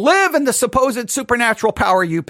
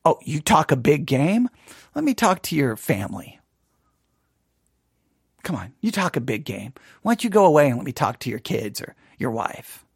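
quiet taunting; 7 semitones lower, 4 dB quieter than usual. Real, not acted. Challenging boastful listeners.

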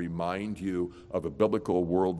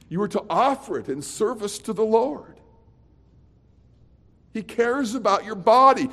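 preaching; 12 semitones higher, 10 dB louder than usual. Acted. Preaching at full force.

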